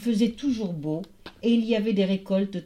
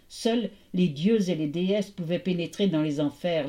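usual speaking voice

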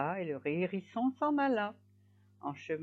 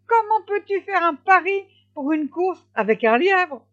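high, loud, outraged scolding; indignant wife; 12 semitones higher, 10 dB louder than usual. A narrator's reading. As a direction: berate a foolish husband in a raised voice. Wife scolds husband over bet. (épouse indignée, voix aiguë et forte)

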